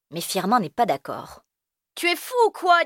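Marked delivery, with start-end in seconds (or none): 1.9-2.8 put-on very high scornful kid; incredulous. Firmin scoffing at Elio's plan to go home. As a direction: scoff at the idea.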